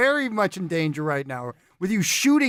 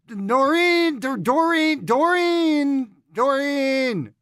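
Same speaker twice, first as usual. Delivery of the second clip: drawn-out, higher-pitched call; 5 semitones higher, much slower than usual. Acted. Doorkeeper summoning Doreen for the visitor, calling out. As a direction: call her name into the house, stretching it.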